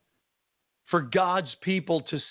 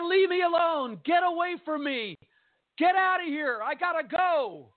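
high, pushed-out shout of a man in shock; an injured man yelling to be left alone; retold yelling; 12 semitones higher than usual. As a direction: throw him off you, frantic. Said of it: "he started yelling at me".

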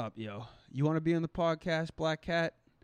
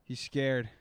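ordinary delivery

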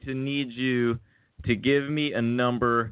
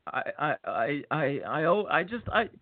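ordinary talk

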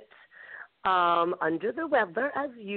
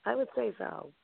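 soft aside; 8 dB quieter than usual. Real, not acted. Repeats phrase twice.

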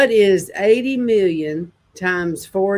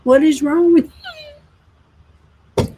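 exasperated outburst; 9 semitones higher, faster than usual. Real, not acted. Exasperated at Brad.

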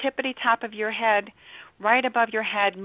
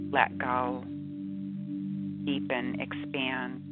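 guided meditation; 3 dB quieter than usual. Acted. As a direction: ease them into calm, softly. Guided meditation over music.

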